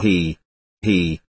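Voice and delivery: low, spoken-out "he, he"; narrated chuckle. A narrator's reading. Maximov pulling out his queen, a low mock chuckle. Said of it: "laughed Maximov".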